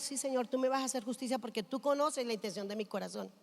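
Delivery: soft retold prayer; quiet trusting prayer. Woman retells telling God she trusts His justice, softly.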